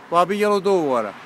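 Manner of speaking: ordinary talk, nothing marked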